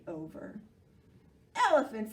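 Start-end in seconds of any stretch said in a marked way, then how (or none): 1.5-2.1 gruff. Acted. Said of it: "said Monkey gruffly".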